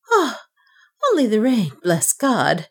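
high relieved sigh easing down; relief. A narrator's reading. Banker relieved it is only rain, high then settling. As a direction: breathe out with relief. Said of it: "with indescribable relief".

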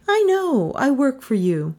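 high voice, easing down at the end; Melissa's voice. A narrator's reading. Melissa interrupting the university president, high voice. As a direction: cut in high.

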